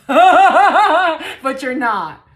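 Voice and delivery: big loud drawn-out laugh into the words. Real, not acted. Reader laughs off the rumor, loud and slow. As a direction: burst out laughing, drag it slowly.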